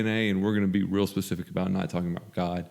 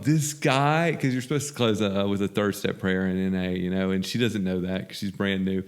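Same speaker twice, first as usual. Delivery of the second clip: ordinary talk, nothing marked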